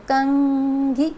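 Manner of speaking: कंघी drawn out very slowly, the न sound clear after the vowel; कंघी, very slow, syllable by syllable; slow demo word. Shown as a demonstration. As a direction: stretch कंघी to show the nasal. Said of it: "तो न का sound बाद में है".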